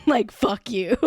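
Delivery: laughing curse. Real, not acted.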